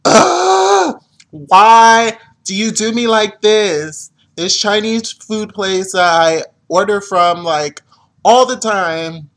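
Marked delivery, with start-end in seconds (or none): 0.1-4.1 high, loud, drawn-out ugh of disgust, then slow; dramatic disgusted whine at the takeout meal; exasperated groan. Retold or imitated. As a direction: moan at the food like it betrayed you. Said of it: "I was just like".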